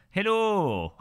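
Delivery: put-on a quick jokey Portuguese accent, Hello said as hilu. Streamer jokingly greets a Portuguese viewer in a mock Portuguese accent. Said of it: "hello with a Portuguese accent".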